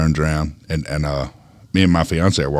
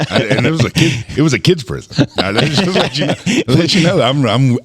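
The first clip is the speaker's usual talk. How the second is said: laughing; 9 semitones higher, 4 dB louder than usual. Real, not acted. Laughing about working at kids prison.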